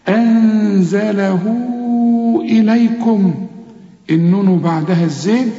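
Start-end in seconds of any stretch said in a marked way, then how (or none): 0.1-3.5 أنزله إليكم drawn slowly; slow recitation. Shown as a demonstration. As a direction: chant slowly.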